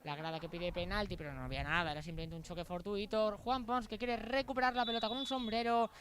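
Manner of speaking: normal talking voice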